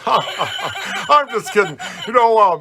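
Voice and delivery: chuckling through the words; laughing. Real, not acted. Brushing off his own sad line, laughing.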